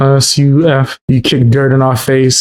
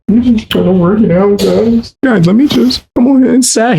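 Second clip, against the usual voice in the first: mocking whine; 7 semitones higher than usual. Mocking the streamer's words.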